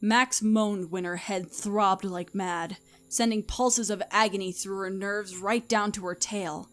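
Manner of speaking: usual speaking voice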